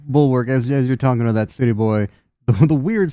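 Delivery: ordinary delivery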